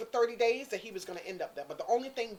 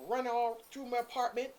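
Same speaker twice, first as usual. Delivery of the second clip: ordinary delivery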